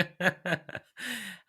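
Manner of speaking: a bright burst of high laughter. Real, not acted. Host cracking up at the guest's story, laughing high.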